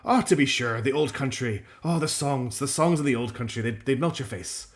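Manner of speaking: put-on wistful old-time Irish fisherman; Irish fisherman accent. Shown as a demonstration. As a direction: lament the old country in a put-on Irish lilt. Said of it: "Imagine a very isolated Irish fisherman".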